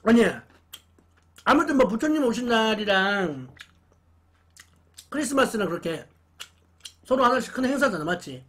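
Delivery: speaking between bites; talking while chewing. Real, not acted. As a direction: mouth full of chicken. Seen 0: Holds chicken at mouth, bites near 3s, chews while talking.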